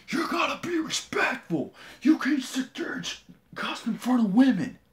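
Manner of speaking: high, loud mocking impression; mock screaming principal. Man mimics the principal screaming about respect, high mock yell. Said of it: "starts screaming in my face".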